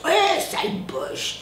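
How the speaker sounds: imitated jeer. Retelling strangers' reaction to a German name, imitated jeer. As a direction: draw out a high mimicked heckle.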